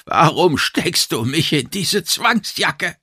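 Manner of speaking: a touch of a groan, drawn out. A narrator's reading. Immobilised narrator questioning the captor, lightly groaning. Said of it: "ächzte ich".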